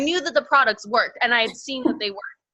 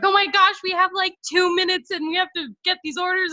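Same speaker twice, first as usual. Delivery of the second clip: much higher, rushed and breathless; frantic excitement; 9 semitones higher, faster than usual. Retold or imitated. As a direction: relive the panic of the month-end rush. Retelling frantic night of orders.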